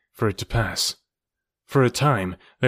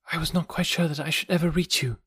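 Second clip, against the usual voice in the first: put-on higher, gentle young woman's voice; man doing a woman's voice; 6 semitones higher, 4 dB quieter than usual. A narrator's reading. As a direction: lift into a girl's voice, softly. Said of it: "she said at last".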